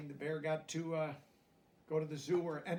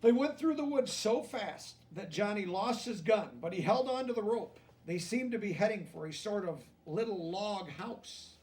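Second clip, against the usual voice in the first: gentle storybook reading, a touch higher; lively picture-book narration; reading to children; 5 semitones higher, 8 dB louder than usual. A narrator's reading. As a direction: read it like a bedtime story to kids.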